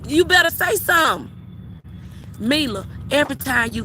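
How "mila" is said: ordinary talk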